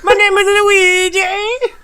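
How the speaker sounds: very high, slow cartoon Italian plumber squeak; Luigi voice. Co-host mocking his partner's voice, high cartoon Italian squeak. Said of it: "and you go".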